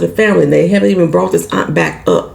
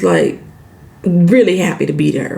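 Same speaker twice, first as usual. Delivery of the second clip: usual speaking voice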